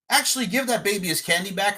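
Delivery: normal talking voice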